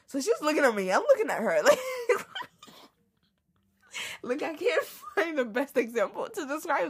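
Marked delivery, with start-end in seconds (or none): 1.6-2.4 a sudden high burst of laughter. Real, not acted. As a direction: giggle breaking out mid-story. Laughing at awkward stare.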